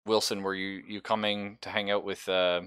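ordinary delivery

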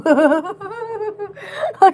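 slow, eerie laughing words; ghostly laugh. Real, not acted. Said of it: "I sound like a ghost when I still laughed".

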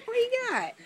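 high-pitched questions to a dog; playful pet talk. Real, not acted. Owner asking dog what it has, playful.

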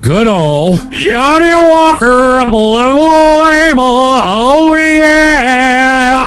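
loud, slow, gloating relish; smug booming whiskey connoisseur; hammed-up savoring. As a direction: oversell the drink like an ad. Host hams up savoring drink.

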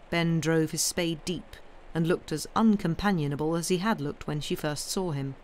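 ordinary delivery, nothing marked

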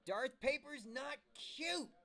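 loud, high, drawn-out outburst; furious high-pitched yell. Acted. Harvey objects to the girls' cooing, yelling furiously high-pitched. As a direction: yell it high and slow, outraged. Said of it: "His voice got loud and high-pitched".

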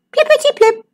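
squeaky high plippity-plip; playful high sound effect. A narrator's reading. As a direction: pop the pips out in a high squeak. Pips flying over the hedge.

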